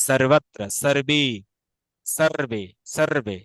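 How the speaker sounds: 'sarvatra, sarve' for সর্বত্র, সর্বে, with a true 's'; rolled r in সর্বত্র, সর্বে; Sanskrit dental s. Shown as a demonstration. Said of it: "আমরা এখানে স". (সংস্কৃত উচ্চারণে সর্বত্র, সর্বে)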